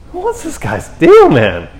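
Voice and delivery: very high mock-alarmed squeal; spooked disciple. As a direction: squeal it in hammed-up bewilderment.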